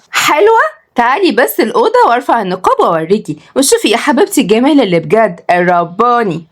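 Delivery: high, affronted start then boasting; vain young lady. Acted. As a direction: show off your beauty to the girl. Voices vain Kenzi boasting. (بغرور ودلع)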